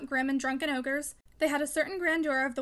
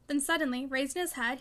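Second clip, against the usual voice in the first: plain reading voice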